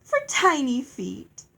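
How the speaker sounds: high, drawn-out teasing; amused fairy. A narrator's reading. Fairy joking about the shoe story, playfully amused. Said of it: "Lucinda laughed".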